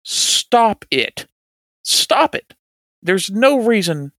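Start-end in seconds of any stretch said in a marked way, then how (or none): 0.0-2.5 emphatic. Real, not acted. Coach demanding the defense stop.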